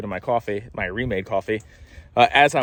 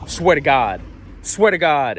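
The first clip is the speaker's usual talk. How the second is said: in his face, demanding; pushy demand; slower than usual. Retold or imitated. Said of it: "as if, like, if I swear to God".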